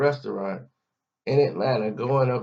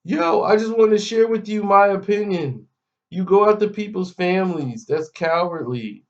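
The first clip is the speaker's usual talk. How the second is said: high, loud imitation of an indignant rant, easing off; mock a guy yelling his opinion in someone's face; mock screaming; 7 semitones higher, 4 dB louder than usual.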